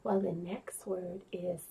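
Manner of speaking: low, hushed and slow; quiet. Acted. Drops voice to whisper word.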